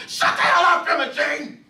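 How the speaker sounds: high, loud snapping at her; angry shout. Acted. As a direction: shut her down hard. Gus shouts at wife.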